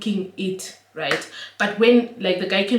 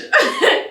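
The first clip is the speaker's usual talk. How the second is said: high laugh; 7 dB louder than usual. Real, not acted.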